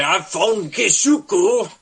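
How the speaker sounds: high, sing-out pretend shout; mock announcement. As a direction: exaggerate it as if announcing a find. Mock announcement of what she'd say.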